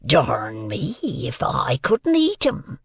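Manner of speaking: slow, husky, drawn-out menace; threatening convict. A narrator's reading. Convict threatens to eat the boy, slow and threatening. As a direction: rasp it slowly as a threat. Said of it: "with a threatening shake of his head".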